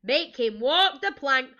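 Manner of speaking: loud, slow, drawn-out order; pirate yell. A narrator's reading. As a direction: call it slowly for the crew. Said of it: "yelled Ben Buckle and Percy Patch".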